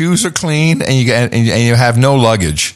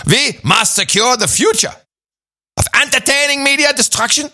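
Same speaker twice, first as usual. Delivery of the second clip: mock shout; 13 semitones higher than usual. Mock German rant peaks.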